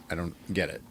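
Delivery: usual speaking voice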